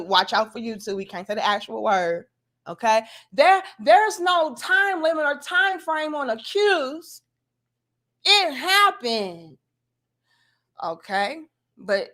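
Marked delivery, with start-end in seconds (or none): 3.3-9.6 mocking viewers. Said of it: "I get what y'all are saying".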